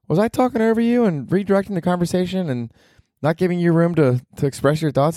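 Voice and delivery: mock meek self; sheepish question. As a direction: ask nervously, half mocking yourself. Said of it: "I was like".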